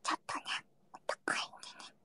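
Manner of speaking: barely audible confidential whisper; hushed whisper. Real, not acted. Streamer obliges whisper-loving chat about prices, hushed whisper. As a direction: whisper it quickly, as if not to be overheard. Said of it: "ひそひそ助かる".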